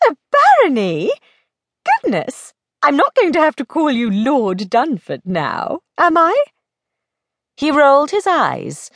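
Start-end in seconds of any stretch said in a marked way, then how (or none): 0.1-6.4 high, surprised lady friend; teasing young lady, astonished. Acted. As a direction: exclaim in surprise, then tease him. Plays Belle, astonished friend.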